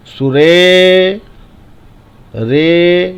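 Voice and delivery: सुरे with the final रे held long; long drawn-out vowel. Shown as a demonstration. Teacher shows that long vowels take time, vowel drawn out. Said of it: "बड़ी पाई में हमेशा समय लेंगे".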